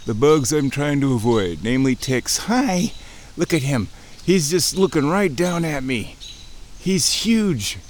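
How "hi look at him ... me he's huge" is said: high, thrilled hello; real excitement, pitched well up; excited owl greeting. Real, not acted. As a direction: marvel at the huge owl overhead.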